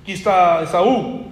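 a nervous son faking his brother's manly voice; a hint of forced macho tone; faked manly voice. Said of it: "Aquí fingiendo la voz, con más testosterona".

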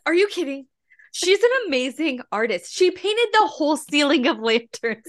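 high-pitched mock indignation; imitating mad kid. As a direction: protest in disbelief like an offended child. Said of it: "he was so mad".

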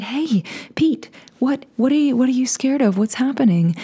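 tender retold questioning; gentle concern. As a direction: coax a scared friend to open up. Comforting a stiff, scared friend.

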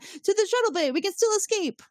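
put-on high-pitched kid voice. Retelling the alien kid's line.

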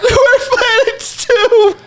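high, slow, teary cry of wonder; tearful high joy. Retold or imitated. Friends hugging after the trip, answering in high tearful joy. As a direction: stretch it out, voice high and near tears.

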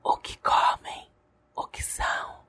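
hushed documentary whisper; dramatic whisper. Acted. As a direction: whisper it like a mystery show. TV-show style whispered intro.

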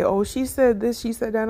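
high mocking gossip voice; gossipy mimic. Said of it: "They would just say".

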